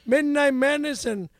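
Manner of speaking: loud sportscaster announcing; Howard Cosell. Shown as a demonstration. Announcing a midnight sports show, Howard Cosell. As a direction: belt it out like a big sports broadcast. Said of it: "Howard Cosell".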